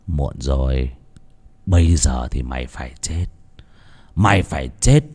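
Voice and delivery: low, flat death sentence; low gangster threat. A narrator's reading. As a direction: pronounce his doom, voice sinking.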